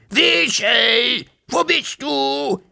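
high, drawn-out calls; frantic dragon shouting into the forest; loud alarmed calling. A narrator's reading. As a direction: call out slowly for a lost friend. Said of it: "so laut es nur ging".

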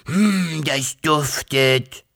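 slow, savouring dragon sniff; happy dragon hiss. Acted. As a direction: hiss with pleasure at the smell. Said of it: "fauchte der Drache glücklich".

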